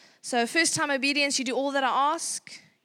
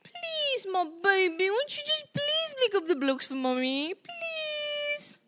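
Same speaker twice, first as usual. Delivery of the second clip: much higher, sing-song begging mom; appeasing pleading; 11 semitones higher, slower than usual. Shown as a demonstration. Mom demonstrating the appeasing style, high pleading. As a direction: beg the child sweetly. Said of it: "Then you get appeasing".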